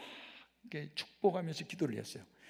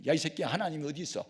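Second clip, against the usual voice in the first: put-on fast, louder drunken taunt; drunken yelling; 5 semitones higher, 5 dB louder than usual. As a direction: hurl the accusation fast and hot. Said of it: "막 그러는 거예요".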